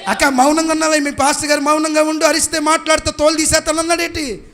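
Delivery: very high, very loud preacher's shout; full-force rallying preaching. Real, not acted. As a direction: whip the crowd up, building to stretched shouts.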